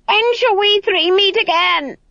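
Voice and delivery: high, shrieking witch's cackle-voice; eldritch shriek. Acted. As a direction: shriek it like an eerie crone. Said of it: "an eldritch voice shrieked".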